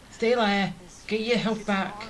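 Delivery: ordinary talk, nothing marked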